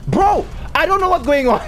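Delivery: high-pitched bewildered cry; baffled. Real, not acted. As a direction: throw it up high in disbelief. Baffled by the game.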